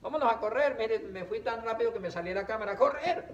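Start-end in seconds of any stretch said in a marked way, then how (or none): 2.8-3.2 mock-excited running cry; excited shout. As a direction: call it out as you dash off. Playful exclaim while running.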